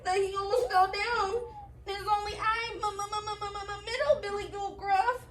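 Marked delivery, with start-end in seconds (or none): 1.9-5.3 high, stuttering goat; stammering scared goat. Acted. Middle goat stuttering to troll, high and scared. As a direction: stammer the m's in fright. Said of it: "shook so hard".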